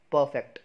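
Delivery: "परफेक्ट" as PUH-fekt, first syllable loud; loud, falling "परफेक्ट" with silent R; correct pronunciation. Shown as a demonstration. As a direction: hit the start of "परफेक्ट", no R. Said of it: "इसकी सही pronunciation है".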